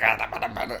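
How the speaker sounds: lightly imitated annoying rapper babble; a hint of an irritating rap alter ego. Showing the rapper's alter ego, lightly annoying voice. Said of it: "his really annoying one".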